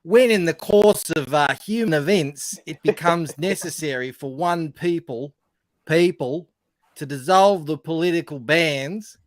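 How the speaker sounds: broad Australian accent demo. Shown as a demonstration. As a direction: read it like Steve Irwin, broad and loud. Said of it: "have a broad accent, which is like Steve Irwin".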